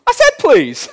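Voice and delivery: high, loud imitation of a child's protest; indignant child. Son corrected by dad over manners, mock indignant high shout. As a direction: squeal it like an exasperated little boy. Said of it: "And he goes".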